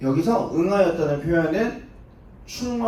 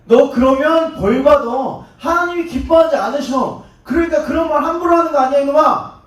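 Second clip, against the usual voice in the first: put-on louder, higher scolding of a naughty boy; scolding a child; 9 semitones higher, 8 dB louder than usual. Elders scolding young Jesus as a brat, loud chiding. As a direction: wag a finger and raise the voice at a kid. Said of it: "어린아이 취급을 한 거예요".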